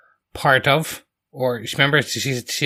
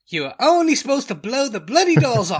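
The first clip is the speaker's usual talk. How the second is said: high-pitched mock Cockney accent, hammed up; a cheeky London geezer accent, pitched up and overplayed; Michael Caine accent; 10 semitones higher than usual. Co-host doing the requested Cockney film line, in a hammed-up Cockney accent. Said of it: "in the correct accent".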